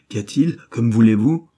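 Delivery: crying out. A narrator's reading. Arrested general shouting at the officers, loud and alarmed. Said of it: "s'écria-t-il".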